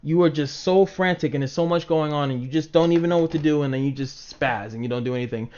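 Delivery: normal talking voice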